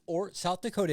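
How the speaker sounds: ordinary delivery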